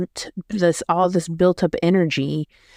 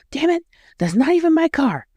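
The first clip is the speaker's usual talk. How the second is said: high-pitched mock exasperation; exasperated outburst; 10 semitones higher, faster than usual. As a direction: imitate an angry woman snapping, pitched way up. Retelling mean woman's angry outburst.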